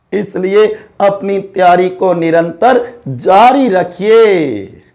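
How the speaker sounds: ordinary delivery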